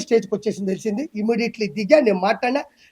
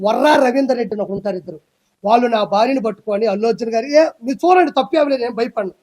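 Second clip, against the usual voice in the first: ordinary talk, nothing marked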